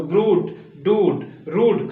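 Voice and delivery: ब्रूट, डूड, रूड each with pure oo; teacher's list, रूड as rood, word by word; plain oo sound. Shown as a demonstration. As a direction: model ब्रूट, डूड, रूड slowly, no y-glide. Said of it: "ऊ का sense देगा".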